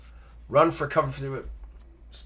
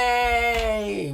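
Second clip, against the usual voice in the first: long, high drawn-out cheer; playful cheer; 9 semitones higher than usual. Pretend. Player celebrating still being alive, playful cheer. Cheers surviving the game section.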